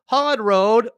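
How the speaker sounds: big welcoming host voice opening the segment; show announcer intro. Acted. Announcing the Brew Review segment.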